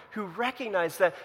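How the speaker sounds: normal talking voice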